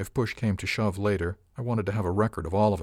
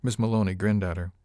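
ordinary delivery

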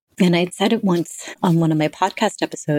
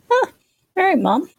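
surprised. Retold or imitated. Retold reaction to mom's text.

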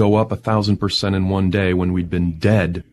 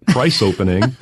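ordinary talk, nothing marked

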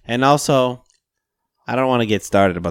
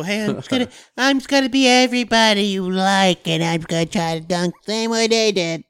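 mock Donovan Mitchell; 8 semitones higher, 3 dB quieter than usual. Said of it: "Donovan Mitchell".

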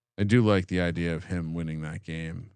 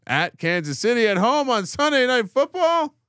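exasperated; 14 semitones higher, 5 dB louder than usual. Real, not acted. Reading brutal schedule stretch.

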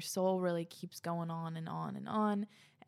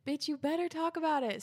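high, quick, louder imitation of insistent fans; mock demanding; 9 semitones higher, 5 dB louder, faster than usual. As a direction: act out pushy followers, pitched up and fast. Acting out listeners' demanding replies.

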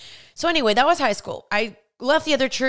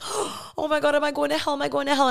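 higher, gasping retold dread; panicked gasp; 5 semitones higher than usual. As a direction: gasp then fret in mock panic. Ashamed child fearing hell.

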